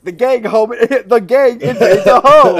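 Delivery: a hint of a sitcom opener announcer; mock-announce it as a show title, laughing; TV show title card. Friend riffing on a sitcom title, mock announcer read. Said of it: "Always Sunny in Philadelphia open".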